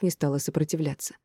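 plain reading voice

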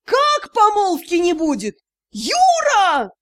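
high anguished wail after them. A narrator's reading. Mother wailing after fleeing son, high and anguished. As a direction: cry out in dismay, rising on the name. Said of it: "вслед нам нёсся вопль".

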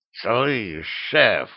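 hissed, lightly voiced cat; angry hiss. A narrator's reading. The cat confronting his boss, hissing angrily. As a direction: hiss it crossly. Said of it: "сердито прошипел он".

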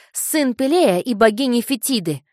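put-on proud boy. A narrator's reading. Boy proudly declares lineage.

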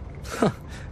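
a quick laugh snorted under the breath; stifled laugh. Real, not acted. Hearing a coworker's old nickname, a stifled laugh. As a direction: try to hold the laugh in and fail. Hears colleague's childhood nickname.

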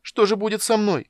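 high, loud tearful plea; sobbing. A narrator's reading. Kender sobs to the goddess about his fate, high and loud. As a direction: sob the question out. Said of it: "всхлипнул Тасс".

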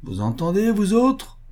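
high, rising call to the crowd; strong-voiced call. A narrator's reading. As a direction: call everyone to witness. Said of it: "cria-t-il d'une voix forte".

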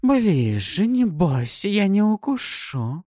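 high, slow, teasing coax; seductive demon. Acted. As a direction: coax him closer. Succubus lures him.

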